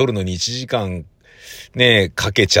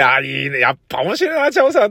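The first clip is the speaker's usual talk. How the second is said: delighted exclamation; 5 semitones higher than usual. Retold or imitated. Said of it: "っつってね".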